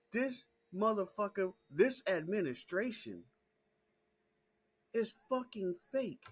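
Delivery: high, loud and worked up; heated shouting rant. Real, not acted. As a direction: yell it like you can't believe nobody sees it. Rant continues after laugh.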